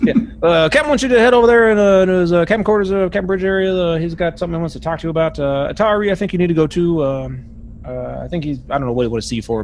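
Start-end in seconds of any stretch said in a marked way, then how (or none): 0.4-7.0 high, loud bustling steward; Buzz the steward. Acted. Steward sends both to the captain, loud. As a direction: urge them toward the captain.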